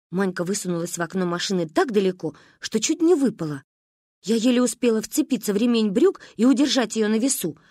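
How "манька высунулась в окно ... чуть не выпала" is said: usual speaking voice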